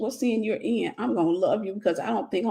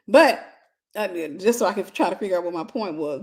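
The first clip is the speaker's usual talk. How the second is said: ordinary talk, nothing marked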